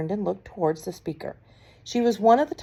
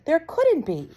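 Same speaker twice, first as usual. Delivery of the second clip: shouting; 10 semitones higher, slower than usual. A narrator's reading. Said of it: "the others shouted".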